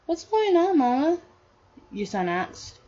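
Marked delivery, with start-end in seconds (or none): 0.0-1.2 put-on high-pitched little kid asking; child's voice. Son asking Mama what's happening, in a high child's voice. Young son asks his mother.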